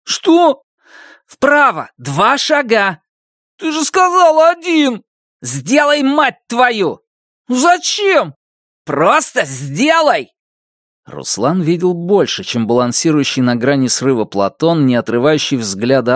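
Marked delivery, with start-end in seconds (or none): 0.0-10.3 high, urgent commands and protests; tense shouting. Acted. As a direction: shout over the barking. Tense shouted exchange near dog.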